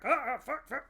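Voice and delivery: high, loud startled cursing with a laugh; panicked guards. Acted. Guards spot her and curse in high, loud panic. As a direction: blurt it out as a guard caught off guard.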